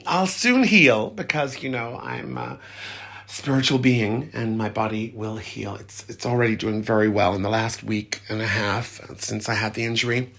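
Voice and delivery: usual speaking voice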